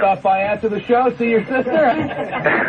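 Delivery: squeaky comic persona needling a guest; falsetto comic character. Acted. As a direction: tease the caller in a silly high voice. Host's put-on character voice.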